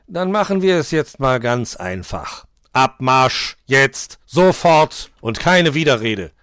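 loud, forceful marching orders; commanding. A narrator's reading. Nikolaus orders the two out the door, commanding. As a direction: order them out, each command punched loud. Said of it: "kommandierte der Nikolaus".

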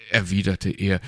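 ordinary delivery

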